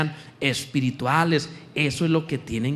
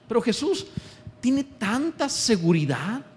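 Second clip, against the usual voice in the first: ordinary talk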